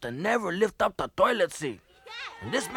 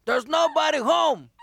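yelling out; 6 semitones higher than usual. Acted. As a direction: shout it at the door. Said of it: "yelled out".

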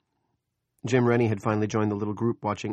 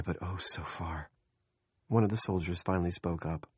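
ordinary delivery, nothing marked

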